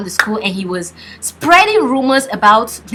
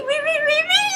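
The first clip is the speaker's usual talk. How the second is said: squeaky high really. Shown as a demonstration. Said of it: "really repeated for emphasis".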